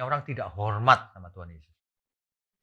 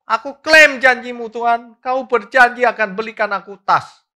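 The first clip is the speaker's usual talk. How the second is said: mock wailing plea; 11 semitones higher, 12 dB louder than usual. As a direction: imitate her wailing for a bag, much higher. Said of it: "sambilnya nangis-nangis setiap hari".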